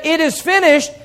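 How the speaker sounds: high, ringing declaration of victory; exultant preacher's cry; triumphant cry. Acted. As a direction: proclaim it as a victory won. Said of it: "it's a triumphant cry".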